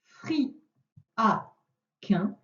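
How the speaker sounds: fri, a, quin as three clipped beats; syllable by syllable. Shown as a demonstration. Teacher reading jumbled syllables to the pupil, one by one. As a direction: read fri, a, quin apart with pauses. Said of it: "Et le dernier".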